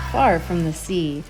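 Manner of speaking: ordinary delivery, nothing marked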